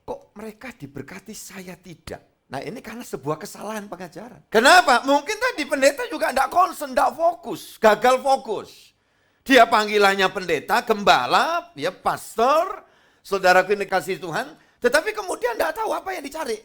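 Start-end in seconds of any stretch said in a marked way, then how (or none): 0.0-2.5 slow, soft, sulky envious whine; envious grumble. Retold or imitated. Jealous churchgoer complaining to himself, softly sulky. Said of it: "orang Kristen ngiri". 4.5-16.6 loud, high preaching at full force. Acted. Pastor rebuking unfocused pastors, loud fiery preaching.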